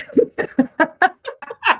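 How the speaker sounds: high-pitched laughter at herself; high giggling laugh. Real, not acted. Laughs after her accent attempt.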